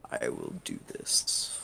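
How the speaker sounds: very low, softened demon voice; low ominous creature voice; menacing fiend. Acted. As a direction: warn her in a deep, quiet voice. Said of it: "and says".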